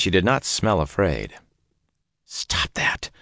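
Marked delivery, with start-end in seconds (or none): 2.2-3.1 whispered to himself; self-rebuke. A narrator's reading. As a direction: hiss it at yourself. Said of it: "Perrin told himself".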